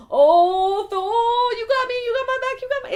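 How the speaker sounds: a hammed-up swoon over Thor; gushing mock devotion. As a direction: gush like an adoring fan. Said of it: "And he's like".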